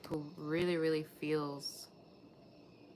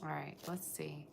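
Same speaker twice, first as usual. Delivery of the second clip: normal talking voice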